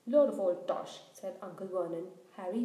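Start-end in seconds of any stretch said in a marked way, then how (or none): 0.1-1.0 blurted scoff, much louder. A narrator's reading. Uncle interrupting the giant, loud and dismissive. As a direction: bark the dismissal out.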